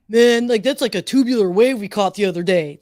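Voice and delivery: put-on loud SoCal surfer accent; SoCal surfer talk. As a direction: belt it out like a Southern California surfer. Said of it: "SoCal surfer talk".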